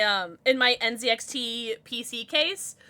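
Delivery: normal talking voice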